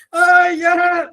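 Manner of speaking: high loud yelp; a hammed-up pained shout; mock cry out. Preacher imitates a tormented person yelling during prayer, high loud mock cry.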